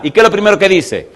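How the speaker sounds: normal talking voice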